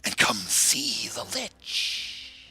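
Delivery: hissed whisper calling out into the woods; loud stage whisper summoning someone; whispering message. Acted. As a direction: whisper it, coaxing the little one out. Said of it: "sends out a whispering message".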